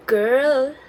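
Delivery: ordinary talk, nothing marked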